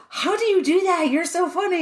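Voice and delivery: high-pitched, gushing; excited praise. Retold or imitated. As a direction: gush over how funny she is. People praising her.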